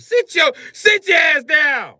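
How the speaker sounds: put-on furious shout at the girls; angry yell. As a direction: bark it out, loud and slow. Yelling at girls over Jumanji.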